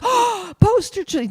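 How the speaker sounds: sharp gasp, then high-pitched amazement; gasping recognition. Retold or imitated. Musician recognizing a band, gasping amazement. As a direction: imitate a starstruck double-take.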